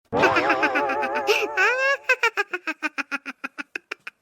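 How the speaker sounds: long hearty laugh at his wife's expense; loud mocking laugh. Husband laughs at his wife's bicycle shame, loud mocking laugh. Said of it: "शर्म नहीं आती इतनी जोर जोर से हंसते हुए".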